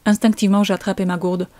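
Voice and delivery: plain reading voice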